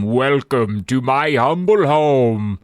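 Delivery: slow, eerie host welcome; low haunting voice. Acted. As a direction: draw it out like a ghostly host. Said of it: "a deep, eerie voice welcomes them".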